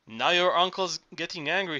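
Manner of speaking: usual speaking voice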